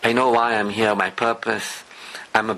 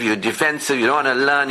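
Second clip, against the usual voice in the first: usual speaking voice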